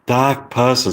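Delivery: "Dark person" as dahk pah-sun; British accent. Shown as a demonstration. Practising British accent, "Dark person" with no Rs. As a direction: drop both Rs in "Dark person". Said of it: "So instead of person, you say".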